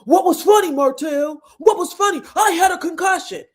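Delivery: high, loud mock shouting; retold angry arguing. Retelling Maurice arguing with Martell, high angry shout. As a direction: yell it as an indignant challenge. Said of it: "They're still arguing".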